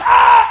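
high, loud cornered shriek; hammed-up scream of panic; mock scream. Boy up against the fence, loud mock scream. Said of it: "up against the fence going".

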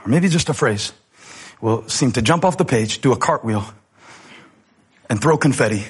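ordinary talk

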